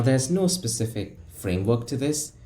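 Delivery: usual speaking voice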